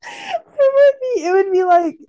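high, drawn-out laughing voice; laughing while talking. Real, not acted. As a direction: push words out through giggles. Cracking up at the imagined scene.

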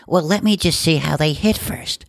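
high, put-on snooty woman; mock the picky golfer lady. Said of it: "She's like".

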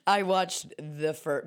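normal talking voice

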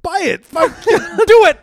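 high, loud mock urging; egging on. Friend pushing his buddy to buy a watch, loud egging on. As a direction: shout it with a laugh, high-pitched and slow on "do it".

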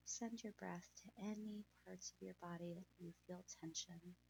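breathy, hushed calming guide; guided meditation. Acted.

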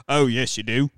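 a touch of Python-style Englishman; Monty Python British accent. Acted. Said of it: "like, a Monty Python British accent".

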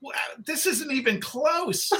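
high-pitched mock disbelief; incredulous retold dismay. Illustrator hearing who will play the author, incredulous. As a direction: let the voice jump up in comic dismay. Retells his dismay at the casting.

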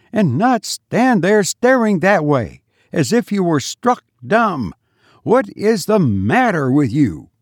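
high scolding, easing down in pitch; peremptory. A narrator's reading. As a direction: demand an answer from the tongue-tied maid. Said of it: "who could be peremptory".

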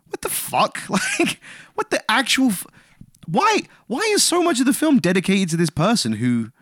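high-pitched, incredulous outburst; exasperated disbelief. Retold or imitated. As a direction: retell it as a baffled, high-pitched rant. Retold exasperated reaction to the ending.

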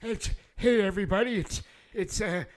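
put-on high, loud cranky old man, half-shouting; a deaf old-timer yelling back at Sonny. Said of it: "I was in the middle of doing my old man voice".

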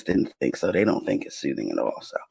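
ordinary delivery

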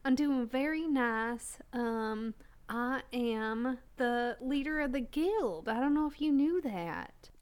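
low, slow Southern drawl; Southern accent. Acted. Guild leader showing off the accent, slow Southern drawl. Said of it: "You like the accent?".

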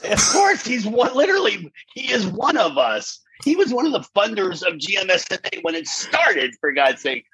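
high-pitched, exasperated insistence; indignant insistence. Retold or imitated. Contestant defending Sondheim as one of us, indignant insistence. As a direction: argue it as too obvious to ask.

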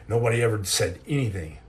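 ordinary talk, nothing marked

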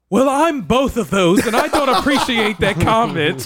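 high, loud mock indignation; hammed-up affronted retort; flamboyant country twang. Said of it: "In that slight country twang".

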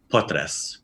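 potres with a soft s sound on the end; soft final s. Shown as a demonstration. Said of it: "that little soft S sound on the end".

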